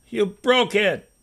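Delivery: put-on child's accusing snap, slow; a touch of angry blame; sharp accusation. A narrator's reading. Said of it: "David shot".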